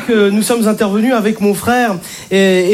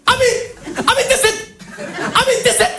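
much higher, squeezed yelp of the name; furious clipped name; 13 semitones higher, slower than usual. Retold or imitated. An enraged father yelling at his pregnant daughter, squeezed high. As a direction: spit the name out in fury. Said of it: "il contracte tout".

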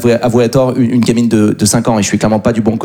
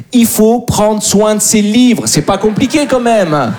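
« prendre soin de ses livres » martelé lentement, voix haute; détache chaque mot de « Il faut prendre soin de ses livres », exaspéré; leçon martelée; 8 semitones higher, slower than usual. Acted. Morale finale martelée au public. (morale assénée lentement, plus aiguë, mot par mot)

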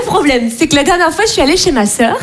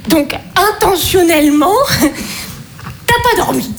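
lentement, incrédule; 5 semitones higher, slower than usual. Acted. Sœur choquée, ralentit.

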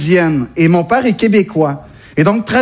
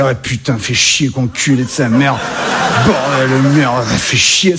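low-pitched, grumbling mock Parisian accent; imitation d'accent parisien; 8 semitones lower than usual. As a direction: do a caricature of a Parisian, dropped well below your own voice. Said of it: "je peux essayer d'imiter l'accent français, l'accent parisien particulièrement". (imitation grave d'un Parisien râleur)

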